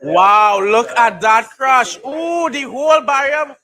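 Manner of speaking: loud, awed crash reaction; awed wow. Real, not acted. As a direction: marvel out loud at the wreck. Watching crash replay.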